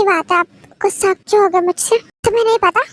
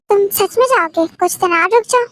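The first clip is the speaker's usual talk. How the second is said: usual speaking voice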